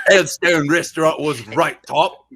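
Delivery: slow, exaggerated Cockney; Cockney accent. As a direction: ham up a Cockney accent, slowly. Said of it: "It's very Cockney".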